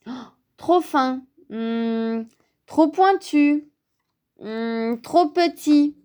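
high verdicts between pondering hums; picky. A narrator's reading. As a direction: reject each one like a fussy child. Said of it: "Petit Ours était exigeant". (exigeant, voix d'enfant difficile)

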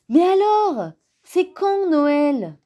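put-on high, drawn-out childish plea; impatient little bear. A narrator's reading. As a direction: whine for an answer. Small bear impatient for Christmas. (impatience d'enfant, voix aiguë)

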